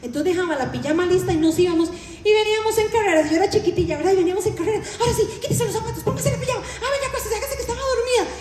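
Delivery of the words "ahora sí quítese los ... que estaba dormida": put-on high, fast, laughing mother hustling the kids to bed; hurried mother. Mother hurrying her little daughter into bed before dad arrives, high and fast. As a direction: rush them into pajamas in a high laughing voice.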